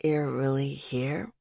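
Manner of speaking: low, drowsy medicated voice; groggy man. A narrator's reading. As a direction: murmur it half awake. Drugged man wakes.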